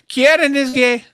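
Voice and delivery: high, hammed-up Irish woman's voice; a mock Irish lady reading the chat line; terrible Irish accent. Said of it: "That's a terrible Irish accent".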